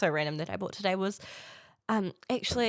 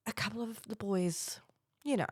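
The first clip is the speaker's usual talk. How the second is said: whispered gossip; 8 dB quieter than usual. Real, not acted. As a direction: lean in and whisper it conspiratorially. Gossiping about the boys.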